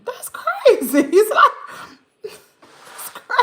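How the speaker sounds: words breaking up into laughter; laughing. Real, not acted. Streamer reacting to crazy goings-on in chat, laughing. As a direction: laugh through the words, trailing into breathless giggles.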